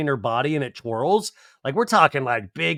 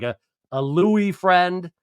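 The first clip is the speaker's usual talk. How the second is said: normal talking voice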